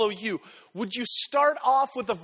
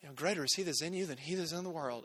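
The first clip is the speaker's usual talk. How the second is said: much softer, mock sanctimonious; preachy platitude; 6 semitones lower, 10 dB quieter, faster than usual. Pious friend answering hard times, mock sanctimonious. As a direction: recite the verse with hollow piety. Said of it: "And you're like".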